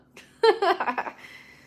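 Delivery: big high laugh; laughing at her own joke; loud high laugh. Real, not acted.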